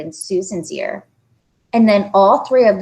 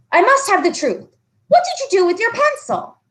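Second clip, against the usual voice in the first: stern teacher demanding; 14 semitones higher, faster than usual. Acted.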